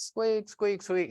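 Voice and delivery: high, squeaky car-noise imitation; imitated squeak. Describing his car's weird noise, high squeaking imitation. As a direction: squeak like a worn car part.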